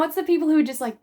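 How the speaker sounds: ordinary talk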